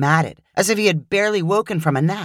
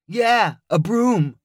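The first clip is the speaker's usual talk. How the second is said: Luke's voice; 4 semitones higher, much slower than usual. Acted. Luke sets up his pun.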